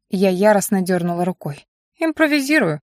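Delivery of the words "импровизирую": sassy, pitched up; a cheeky, teasing reply. Acted. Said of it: "прозвучало в ответ нахальное".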